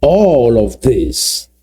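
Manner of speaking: drawn out very slowly; slow deliberate opening. Shown as a demonstration. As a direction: lay each word down slowly. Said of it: "Let me repeat that slowly".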